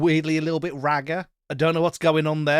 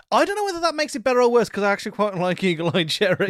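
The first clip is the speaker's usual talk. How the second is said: excited; 8 semitones higher, 4 dB louder, faster than usual. Real, not acted. Excited about the song.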